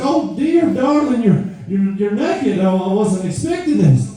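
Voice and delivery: high-pitched, flustered mock shock; shocked mother. Mother finding her married daughter undressed at the door, shocked. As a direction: play a scandalized mum, pitched well up. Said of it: "her mom is just shocked".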